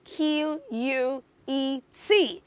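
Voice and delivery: letters read slowly one by one; slow letter-by-letter spelling. Shown as a demonstration. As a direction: spell B O U Q U E T deliberately for kids. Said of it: "today is the word".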